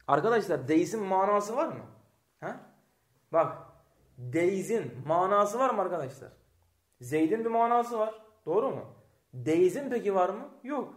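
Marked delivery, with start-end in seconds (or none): none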